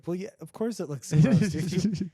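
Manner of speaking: 1 mock indignant Gordon. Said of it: "and it's like".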